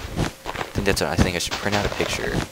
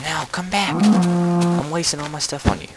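high, drawn-out complaint; whiny pleading; 12 semitones higher, 4 dB louder than usual. Pretend. Player chasing a runaway animal, high whiny plea. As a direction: plead after it in a high wail.

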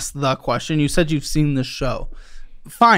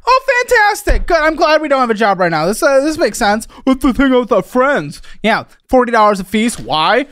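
exasperated, high-pitched mock delight and ranting; sarcastic outburst; 5 semitones higher than usual. Real, not acted. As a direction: rant in disbelief at the bills. Host exasperated at missed payments.

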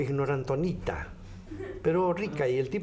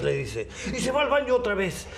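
normal talking voice

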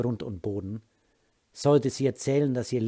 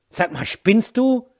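outraged protest; 11 semitones higher, 9 dB louder, slower than usual. Acted. Wife pushed back onto couch.

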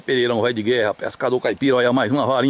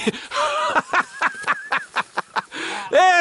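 shouting laugh; 3 dB quieter than usual. Real, not acted. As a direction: howl with laughter. Friend's antics at fishing spot.

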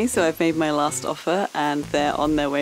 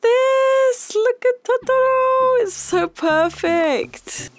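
very high, louder and slow, drawn-out delight; ecstatic squeal; 5 dB louder, slower than usual. Real, not acted. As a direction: squeal over Totoro, stretching each word. Gasps at the Totoro drawing.

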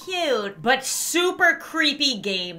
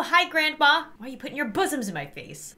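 ordinary talk

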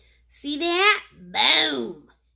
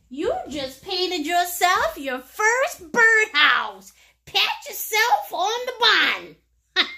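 excited praise; 7 semitones higher than usual. Real, not acted. Teacher cheering students on their first birdhouse, high excited praise. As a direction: cheer them on like a proud teacher.

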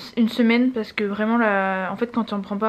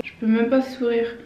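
normal talking voice

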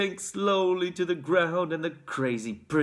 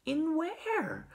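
put-on high, astonished child's cry; boy's high-pitched cry of curiosity; 13 semitones higher, 4 dB quieter than usual. A narrator's reading. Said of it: "cried Charlie".

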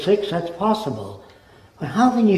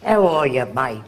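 understated Aussie greeting; lightly voiced Australian, fading on mate; Australian accent; 2 semitones lower than usual. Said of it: "that guy comes from Australia".

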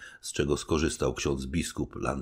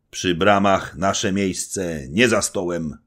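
slow, louder, resolute; firm soldier reply; 5 semitones higher, 8 dB louder, slower than usual. A narrator's reading. Little knight refuses the supper, slow resolute reply. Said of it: "odparł mały rycerz".

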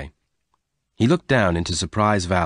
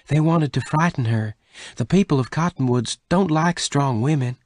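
higher-pitched character voice, earnest explanation; Bess, explaining; 7 semitones higher than usual. Acted. Bess tells Venters who ordered the theft, explaining. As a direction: voice her higher as she explains.

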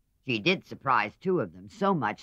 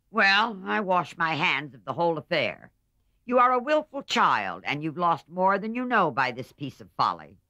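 louder, higher huffy scolding; angry old aunt; 7 semitones higher, 6 dB louder than usual. A narrator's reading. Aunt washing her hands of her niece's match, angry and shrill. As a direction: throw up your hands in disgust. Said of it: "Aunt March was very angry".

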